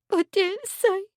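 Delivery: high, frightened little whimper; scared maid whimpering. A narrator's reading. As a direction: shrink away in fright. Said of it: "she whimpered".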